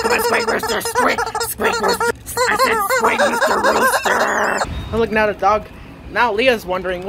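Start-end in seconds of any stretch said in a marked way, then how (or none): 0.0-4.6 much higher, squeaky and giggly. Acted. Kid urges rubber chicken to squeak, high and giggly. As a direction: squeal it like a toy, laughing.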